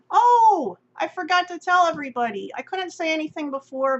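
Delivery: loud, high burst of excitement; sudden remembering. Real, not acted. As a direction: exclaim it as it suddenly comes back to you. Suddenly remembers news to share.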